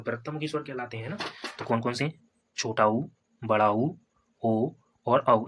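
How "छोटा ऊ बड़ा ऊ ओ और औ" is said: slow drawn-out ऊ and औ with rounded lips; rounded vowel demo. Shown as a demonstration. Teacher slowly models rounded vowels for students. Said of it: "ओंठ गोलाकार बनते हैं".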